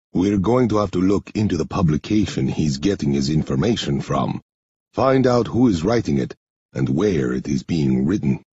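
low, measured man's voice; low-pitched, deliberate character voice; Henri character voice. Acted. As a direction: play Henri low and subdued, slightly foreign.

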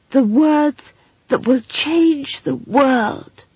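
high, slow and blissful; beatific. Acted. Simon dreaming aloud of his quest, beatific. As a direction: savour each word through a rapturous smile. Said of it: "smiled a beatific smile and added".

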